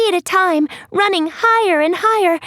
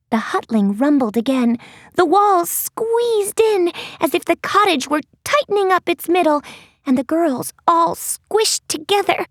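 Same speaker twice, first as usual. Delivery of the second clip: ordinary delivery, nothing marked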